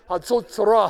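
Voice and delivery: Hatzotzra blared loud and high like a trumpet; trumpet call. Shown as a demonstration. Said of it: "pretend to be playing the trumpet".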